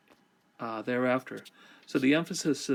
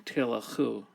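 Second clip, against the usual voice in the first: usual speaking voice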